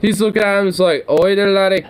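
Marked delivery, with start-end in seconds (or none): 1.1-1.9 put-on Irish accent. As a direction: play a cheeky Irish boy in an Irish accent. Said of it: "I'm giving him an Irish accent".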